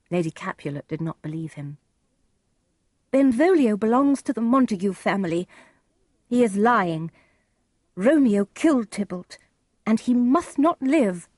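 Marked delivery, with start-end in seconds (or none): 3.1-11.3 raised, insistent accusation; accusing. Acted. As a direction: demand punishment with a touch of outrage. Lady Capulet accuses Benvolio and Romeo.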